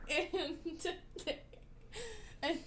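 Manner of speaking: giggling through the words; trying not to crack up; laughing. Real, not acted. Host breaks up laughing.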